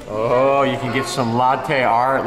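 ordinary talk, nothing marked

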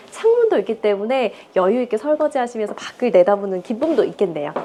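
usual speaking voice

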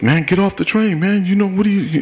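high, whiny mock of an impatient passenger; hurried bystander voiced high and fed up. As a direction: pitch it up as the annoyed rider.

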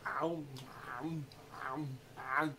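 low, soft chomping "ow"; sheep munching sounds. Shown as a demonstration. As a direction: imitate grazing sheep with spaced chomps. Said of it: "eat like sheep?".

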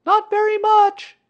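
put-on very high, loud little-boy call; child calling up. A narrator's reading. Boy judging the bear's disguise, shouting up. Child calls answer up to Pooh.

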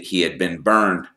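plain reading voice

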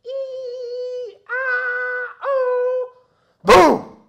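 squeaky high yelps ending in a loud boom; imitated fight noises. Boy hearing parents fight, squealing then booming. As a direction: mimic the fight behind the door, high squeals then a crash. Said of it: "and I heard this".

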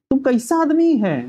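high, drawn-out mock reproach; annoyed. Karmu rebukes Dharmu for his behaviour, annoyed. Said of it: "गुस्सा होता है".

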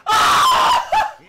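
very high, loud scream; real scream at full volume; excited scream. Real, not acted. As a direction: shriek with joy. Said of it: "I'm yelling so much".

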